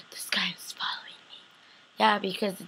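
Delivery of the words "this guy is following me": hushed, scared whisper; paranoid whisper. Retold or imitated. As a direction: whisper like someone is watching. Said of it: "she's like".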